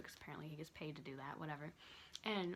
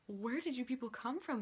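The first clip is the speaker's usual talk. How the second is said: amazed; 5 semitones higher, 6 dB louder than usual. Retold or imitated. Amazed at tall students.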